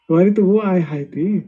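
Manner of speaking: usual speaking voice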